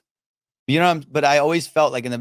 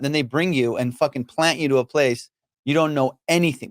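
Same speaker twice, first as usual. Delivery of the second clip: ordinary talk, nothing marked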